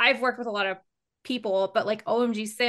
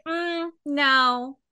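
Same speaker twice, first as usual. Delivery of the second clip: blunt refusal; 4 semitones higher, much slower than usual. Shown as a demonstration. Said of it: "Not like".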